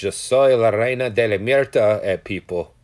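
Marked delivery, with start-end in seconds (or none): 0.0-1.8 loud mock yell in Spanish; playful loud proclamation; retold yelling. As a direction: holler it out in Spanish, half full force. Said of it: "where I start yelling shit like".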